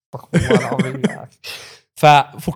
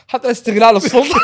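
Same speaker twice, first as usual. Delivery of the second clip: laughing; 8 semitones higher, 4 dB louder than usual. Real, not acted. Joking about abusing power.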